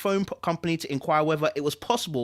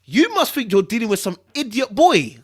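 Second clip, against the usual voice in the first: louder, rising to a higher enraged yell; furious threats; 4 semitones higher, 4 dB louder than usual. Acted. Ex-husband threatening Sky down the phone, furious rising yell. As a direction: menace building into a shouting rage.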